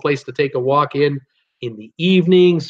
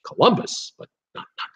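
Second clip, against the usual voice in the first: whispered aside; 5 semitones higher, 4 dB quieter than usual. Real, not acted. Muttering to himself over the list.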